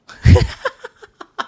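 loud high real laugh; sudden loud laughing fit; burst of laughter. Real, not acted. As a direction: explode into laughter. Hears Stockholm, bursts laughing.

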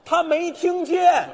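slow loud repeat. Real, not acted. Repeating it slowly and loudly to a partner who keeps missing it. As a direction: spell it out loudly, word by word. Repeating again, louder and slower.

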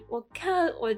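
ordinary talk

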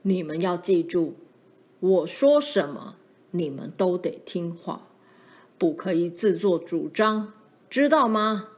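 firm, forceful scolding voice; emphatic fatherly warning, punched phrase by phrase; frightening stern father. Acted. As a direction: lay down the law to the children. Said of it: "声音还是很吓人的".

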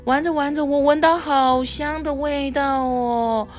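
put-on high, sing-song small boy's voice; little boy. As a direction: play a curious little boy, pitched well up.

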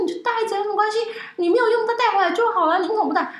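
hammed-up high sister scolding; exasperated big sister. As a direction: nag like an older sister who knows better. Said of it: "然后我姐说".

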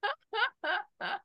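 high, soft giggle; a brief high giggle; soft laugh. Real, not acted. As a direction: giggle quietly. Laughing at teasing.

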